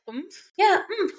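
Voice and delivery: high, cute little oomph. Retold or imitated. Friend imitating Reese saying oomph, cute and high. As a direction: squeak it out cutely. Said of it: "She's just cute".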